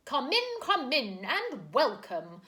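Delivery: a booming, high, jolly welcome; loud cheery call. A narrator's reading. Mister Wig welcoming guests, loud and cheery. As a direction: call out merrily through the door. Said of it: "called a loud, cheery voice".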